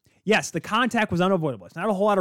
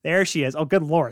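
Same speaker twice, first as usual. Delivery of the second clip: usual speaking voice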